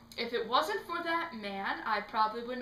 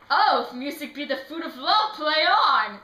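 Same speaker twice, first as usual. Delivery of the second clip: loud, declaimed stage line; theatrical recitation; 2 semitones higher, 7 dB louder than usual. Acted. Actor trying to rehearse the play, theatrical recitation. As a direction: proclaim the verse, lifting the final words.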